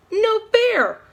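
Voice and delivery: put-on high, loud childish protest; angry blurt. A narrator's reading. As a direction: blurt it out furious. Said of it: "Molly blurted out angrily".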